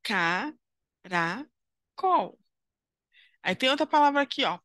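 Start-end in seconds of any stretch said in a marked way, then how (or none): 0.0-1.5 Caracol stretched slowly as ca-ra-col; slow, split into syllables. Shown as a demonstration. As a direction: spell it out by syllables like a teacher. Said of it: "E aí ele separou".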